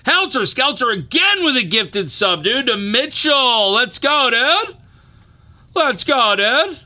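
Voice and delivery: high, loud hype call; hyped shout. Real, not acted. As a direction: shout the thanks, all fired up. Hyped gifted sub call out.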